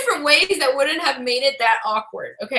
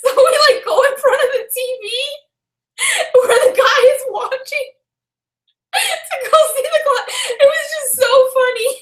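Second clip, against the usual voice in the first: high, teary laughter through the words; laughing so hard it nearly turns to tears; crying laughing; 10 semitones higher than usual. Real, not acted. Retelling walking past the guy's TV, crying with laughter. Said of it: "We were crying".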